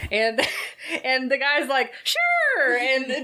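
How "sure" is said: high, squeaky "sure"; breezy acceptance. Retold or imitated. Guy accepting a stranger's carriage ride, breezy and very high. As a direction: chirp it like a trusting passenger. Voicing a too-trusting movie character.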